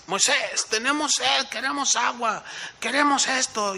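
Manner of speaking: mock-whining people asking Moses for water; complaining crowd. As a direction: beg Moses like a demanding crowd.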